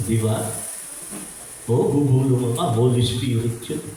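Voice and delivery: normal talking voice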